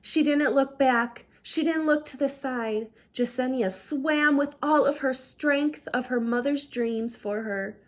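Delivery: ordinary delivery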